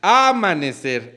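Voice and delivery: amanecer with a long, stressed first A; AAA-manecer, stress up front. Shown as a demonstration. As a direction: hit the first syllable of amanecer. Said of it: "¿Cuál es la sílaba tónica".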